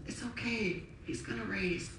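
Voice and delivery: gently calm someone grieving; hushed reassurance, acted; reassuring. Jesus reassures Martha in acted scene.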